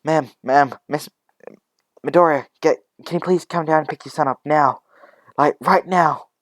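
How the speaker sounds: high, loud panicked phone plea; agitated caregiver calling for help; urgent alarm. Acted. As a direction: urge a parent to come at once.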